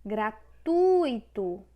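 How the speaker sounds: graTUUito, TU long and loud; stress on TU. Shown as a demonstration. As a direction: slowly swell on the TU of Gratuito. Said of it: "é paroxítona".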